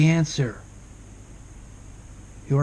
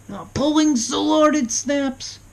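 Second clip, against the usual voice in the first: high, loud, slow and strained; raised, taut, drawn-out delivery; straining; 10 semitones higher, 6 dB louder, slower than usual. Shown as a demonstration. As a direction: say it as if pulling hard. Acts out pulling until it snaps.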